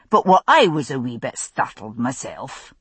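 easygoing Scots grumble; gruff Scots. Acted. Duncan explaining the horses' fright, a hint of gruff Scots. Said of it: "said a gruff voice".

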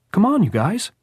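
put-on high, loud boyish call; playful rallying cry. A narrator's reading. Jordan hurrying the others to the burial, playfully rallying. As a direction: call friends out for a game of tag. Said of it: "as if he were trying to get up a game of tag".

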